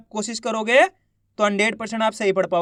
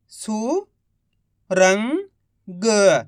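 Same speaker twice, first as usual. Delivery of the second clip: each syllable drawn out very slowly; slow syllable-by-syllable reading; much slower than usual. Shown as a demonstration. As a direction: sound out सुरंग slowly as सु-रं-ग, one sound at a time. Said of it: "एक एक अक्षर का उच्चारण करके".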